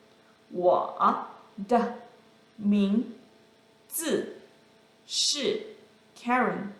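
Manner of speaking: "我", "的", "名", "字", "是Karen" separated by pauses; word by word. Shown as a demonstration. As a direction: give each syllable its own beat and tone.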